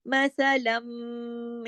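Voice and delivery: मसलम with the closing m held as a long hum; steady nasal hum ending मसलम; nasal ghunnah. Shown as a demonstration. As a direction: linger on the m of मसलम through the nose. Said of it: "ये अकमल गुन्ना कहलाएगा".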